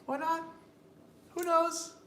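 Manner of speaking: very high-pitched squeaky voice. Shown as a demonstration. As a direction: keep it way up high. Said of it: "You can be talking like this".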